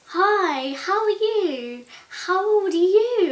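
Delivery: put-on high, slow, sing-song voice for a toddler; exaggerated sugary baby-talk; patronising stranger. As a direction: mimic a woman cooing at a small child. Stranger patronising her in cafe.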